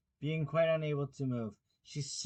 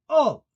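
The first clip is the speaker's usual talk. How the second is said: All! hit very high and loud; great emphasis; 14 semitones higher, 16 dB louder than usual. A narrator's reading. King glares at Alice, All! with great emphasis. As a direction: slam the word All!. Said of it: "he repeated with great emphasis".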